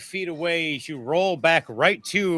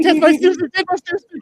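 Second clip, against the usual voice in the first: high, loud squeaky panic; high frantic pleading; panicked protest; 13 semitones higher, 8 dB louder, faster than usual. Pretend. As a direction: yelp it while scrambling away. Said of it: "you're gonna be like".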